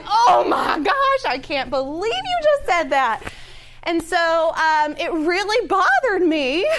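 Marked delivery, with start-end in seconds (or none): 0.1-3.2 high, loud shocked outburst; shocked disbelief. Retold or imitated. Reacting to the pastor's claim, shouting in shocked disbelief. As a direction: blurt it out in laughing disbelief. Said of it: "I just went".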